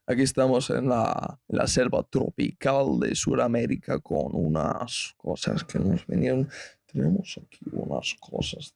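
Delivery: hushed nature documentary voice; documentary narrator. Pretend. Spanish mock nature documentary.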